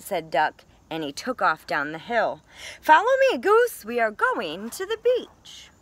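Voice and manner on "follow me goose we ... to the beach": much higher and louder, calling back to a friend. Acted. Duck calls to Goose while running downhill, loud and high. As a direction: holler it over your shoulder as you run.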